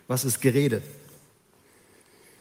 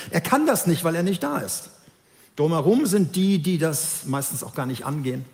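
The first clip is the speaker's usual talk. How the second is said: ordinary delivery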